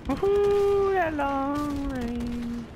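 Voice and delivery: very high, loud woohoo, drawn-out words after; whooping cheer. Real, not acted. Gamer celebrating a long-range kill, whooping.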